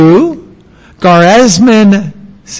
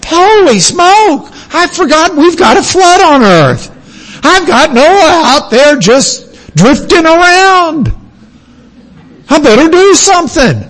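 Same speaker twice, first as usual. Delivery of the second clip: high, hammed-up flustered God who forgot; mock panicked God; 9 semitones higher, 3 dB louder than usual. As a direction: play God suddenly remembering in a panic, much higher. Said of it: "there wasn't a day where he said".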